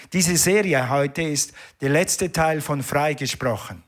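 ordinary delivery

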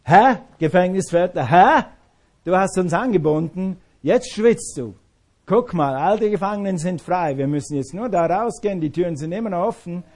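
loud, jeering taunt with scornful Hä; mocking taunt. Paul gloating over the trapped jailer, loud mocking taunt. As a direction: rub it in the jailer's face, loudly. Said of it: "Paulus hätte sagen können".

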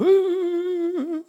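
high, amused mock laugh. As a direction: laugh at the unseeing servant. Mocks servant's cluelessness. (spöttisches Lachen)

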